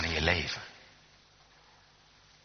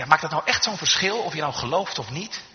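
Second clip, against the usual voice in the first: higher-pitched doubting question; sceptical questioning; 8 semitones higher, 4 dB louder than usual. Retold or imitated. People questioning whether believing makes a difference, sceptical. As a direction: imitate someone doubting that it matters. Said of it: "Soms zijn de mensen die zeggen".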